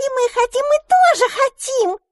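put-on baby mermaids squeaking that they are hungry too. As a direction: squeak it like tiny hungry hatchlings. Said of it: "запищали русалки".

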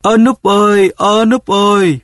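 high, drawn-out wailing call; calling while crying. A narrator's reading. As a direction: call out in an acted tearful wail. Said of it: "vừa kêu vừa khóc". (vừa kêu vừa khóc, giọng cao kéo dài)

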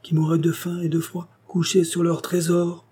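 high, slowing insinuation; knowing hint. A narrator's reading. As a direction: draw out the hint to the end. Doctor hints at a hidden hoard.

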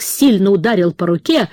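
usual speaking voice

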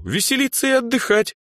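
high, enthusiastic answer; eager high-pitched girl. A narrator's reading. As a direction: announce the fun in a soaring pitch. Excited answer about the party.

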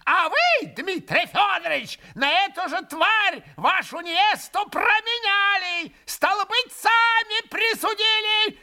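put-on high shrill screeching old man; breathless old person. A narrator's reading. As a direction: spit it out, gasping with rage. Old man keeps shrieking, breathless.

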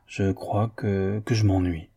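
haltingly owning up to being bored; hesitant admission. Acted. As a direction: let the words drag out reluctantly. Painter hesitantly admits boredom.

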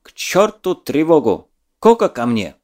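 loud, barked order; commanding shout. Acted. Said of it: "скомандовал".

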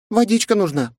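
tiny high fairy voice; pixie. A narrator's reading. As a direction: chirp a helpful hint. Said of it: "подсказала Кэт".

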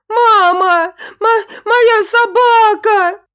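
high, loud sobbing wail, drawn out slowly. Acted. Angelinka sobbing to her mother that the dog vanished. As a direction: sob out the loss. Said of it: "зарыдала Ангелинка".

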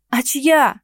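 резко, громче и выше; взвиться с возмущением; вспылив. Acted. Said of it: "взвилась я". (возмущённо взвилась)

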